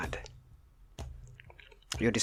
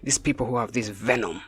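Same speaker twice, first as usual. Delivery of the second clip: ordinary talk, nothing marked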